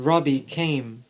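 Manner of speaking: plain reading voice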